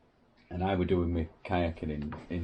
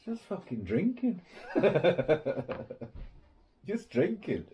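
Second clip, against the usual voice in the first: high, laughing disbelief; 7 semitones higher than usual. Real, not acted. Laughing about police drinking on duty. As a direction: crack up at the memory.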